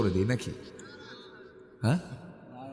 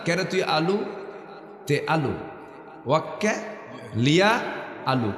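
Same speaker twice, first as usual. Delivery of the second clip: broken into pieces. Shown as a demonstration. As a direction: spell "ওয়াক্কালিয়া" out bit by bit to count the আলু. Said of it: "কয় আলু হলো?".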